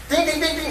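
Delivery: high sing-song ding-dings; imitated ringing. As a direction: chime it like a doorbell going off. Imitating the doorbell camera ringing.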